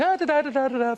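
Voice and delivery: put-on high baby babbling; playful baby babble. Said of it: "It was going".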